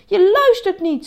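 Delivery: high, loud angry shout. A narrator's reading. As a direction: yell in exasperation. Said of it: "schreeuwde hij".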